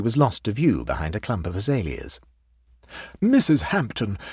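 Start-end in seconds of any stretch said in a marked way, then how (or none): 3.2-4.2 raised, solemn form of address; increased solemnity. A narrator's reading. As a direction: begin a grave pronouncement. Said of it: "began Leonard with increased solemnity".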